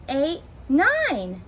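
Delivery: plain reading voice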